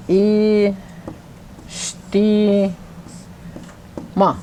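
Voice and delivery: "E, sti, ma" in separate held syllables. Shown as a demonstration. Teacher spelling out a new word, syllable by syllable. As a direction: pause after each part of "E, sti, ma". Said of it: "make sure you know where your diacritical marks are".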